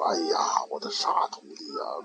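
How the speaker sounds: hushed, breathy sigh at a silly pupil; fond whisper. Acted. Master whispering to his foolish disciple, fondly. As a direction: whisper it like an amused old teacher.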